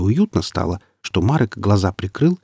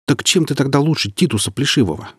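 high-pitched indignant challenge; raised pitch, affronted question; 6 semitones higher than usual. A narrator's reading. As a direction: demand it with mild outrage. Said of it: "возмутился Марек".

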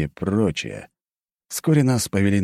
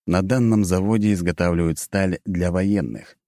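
plain reading voice

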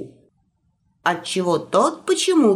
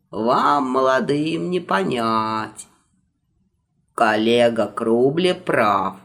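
put-on old magister voice; 6 semitones lower than usual. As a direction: lecture like a fussy old wizard. Eldest magister hosts guests.

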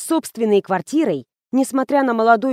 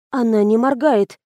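plain reading voice

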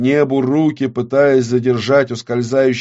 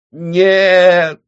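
high, loud, drawn-out no; anguished cry; 9 semitones higher, 7 dB louder than usual. Acted. Witch fails to hold her world, crying out. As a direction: cry out at the loss.

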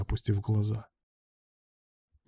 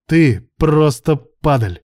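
slow, loud, raised accusation; furious shout; 5 semitones higher, 7 dB louder, much slower than usual. A narrator's reading. Betrayed man condemning his partner, furious. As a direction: shout the insult, drawing out each word.

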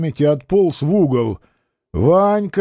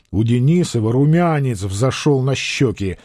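usual speaking voice

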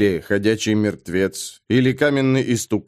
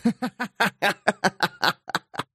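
high, staccato cackling; long mocking cackle; doubled-over guffaw; 8 semitones higher, 5 dB quieter than usual. A narrator's reading. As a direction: laugh helplessly in short high bursts. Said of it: "Бэкфилд согнулся от хохота".

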